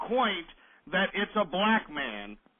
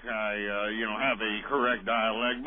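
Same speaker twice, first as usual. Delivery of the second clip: low, mocking imitation of a caller; mock-proper speech, pitched well down; mock correct dialect; 9 semitones lower than usual. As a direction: put on a low, careful voice. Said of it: "I can try to sound like".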